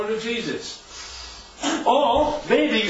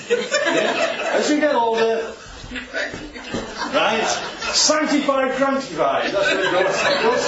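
laughing; 3 semitones higher, slower than usual. Real, not acted. Joking about getting crankified with age.

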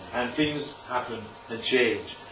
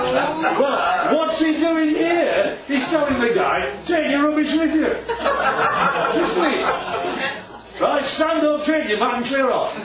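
higher, louder, laughing through the words; 11 semitones higher, 4 dB louder than usual. Real, not acted. Preacher laughing as he jokes about the old clothes. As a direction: joke while laughing hard.

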